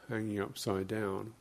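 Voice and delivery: ordinary delivery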